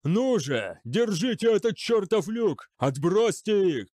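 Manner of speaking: high, loud desperate order; yelled urging. A narrator's reading. General urging men to hold the hatch, yelling. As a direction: drive them on at a yell. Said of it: "вопил Дев".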